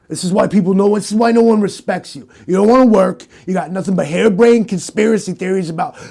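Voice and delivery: loud, exasperated dressing-down; scolding rant. Acted. Acted scolding of the rookie.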